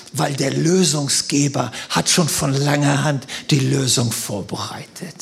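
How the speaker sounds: soft, low, sincere delivery; quiet, low pastoral comfort; hushed earnest preaching. Real, not acted. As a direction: bring it right down to a gentle reassurance. Soft earnest application after funny anecdote.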